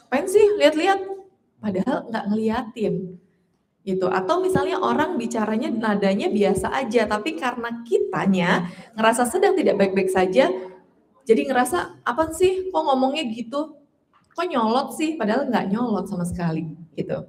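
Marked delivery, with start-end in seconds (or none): 0.1-1.0 touchy snap. Pretend. Voicing a touchy person snapping. 12.1-15.2 irritated, higher-pitched comeback; touchy retort. Pretend. Voicing a touchy person.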